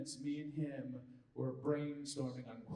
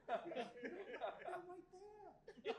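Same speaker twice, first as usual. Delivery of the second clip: laughing; much slower than usual. Real, not acted. Hushing, laughing at memory.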